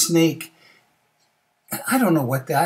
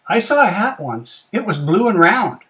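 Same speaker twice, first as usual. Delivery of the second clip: lightly voiced snake, high and loud; a higher, emphatic storybook snake; snake's reply; 7 semitones higher, 7 dB louder than usual. Snake answers.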